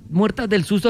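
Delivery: ordinary talk, nothing marked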